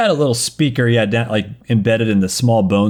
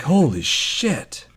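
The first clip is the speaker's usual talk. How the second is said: awed whisper; 5 semitones higher, 4 dB quieter, much slower than usual. Retold or imitated.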